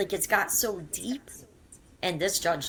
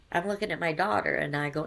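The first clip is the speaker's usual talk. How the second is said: ordinary delivery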